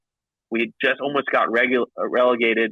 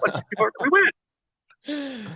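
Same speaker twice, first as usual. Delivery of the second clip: retold stunned squeak; stunned disbelief; 13 semitones higher, much slower than usual. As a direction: act out an incredulous realization, pitched way up. Retelling the stunned moment of winning.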